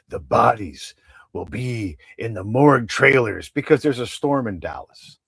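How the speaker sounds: lightly voiced dramatic newsman; mock grave news anchor; David Muir. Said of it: "I watch David Muir".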